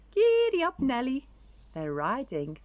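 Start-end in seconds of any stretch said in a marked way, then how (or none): 0.1-1.3 much higher, louder cheer; jolly rider call; giddy up call. Acted. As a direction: urge the elephant on. Riding the elephant skeleton.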